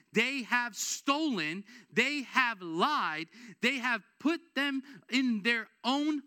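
slow, weighty Bible reading; scripture reading. Acted. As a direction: lay each sin down slowly and heavily. Reads scripture aloud in sermon.

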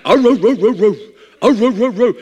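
rhythmic mock barking ro ro ro; imitated dog bark. Pastor imitating the neighbor's barking dog. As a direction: bark it out in a steady cadence. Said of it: "same song every night".

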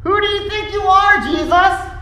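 very high-pitched outraged challenge; incredulous, indignant cry at the top of the voice. Acted. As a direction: burst out in offended disbelief. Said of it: "the elders were indignant".

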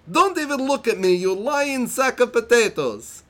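high, loud, indignant mock Italian accent; over-the-top Borat-esque Italian outburst; hammy Italian-Borat accent. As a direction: overact the insult in a silly Italian accent. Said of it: "I'm gonna read it in the best accent that I can".

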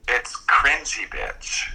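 slow, drawn-out show announcer; segment title announcement. Acted. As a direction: announce the title slowly like a host.

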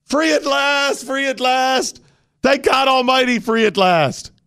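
high, slow, soaring cry of freedom; mock preach it like a great speech at a rally; mock jubilant orator. To his co-host, hammed-up triumphant speech voice.

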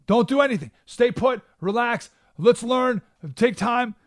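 high, loud mock panicky pleading; fearful advisors. Advisors pleading with Alexander not to act, hammed-up fearful. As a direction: ham up the frightened advisors. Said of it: "They're fearful".

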